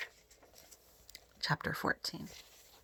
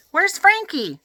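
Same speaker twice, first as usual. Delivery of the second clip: calling out; 11 semitones higher, 3 dB louder, slower than usual. A narrator's reading. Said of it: "I called out".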